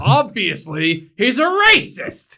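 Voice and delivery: sudden high, loud mock outrage; hammed-up shock at a trivial crime. Mocking online critics with shrill fake outrage.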